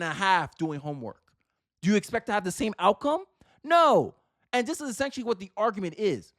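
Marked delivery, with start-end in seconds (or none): 3.6-4.2 sharp, loud and high; a flat, loud no; emphatic no. Real, not acted. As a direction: slam the answer down. Rhetorical answer to own question.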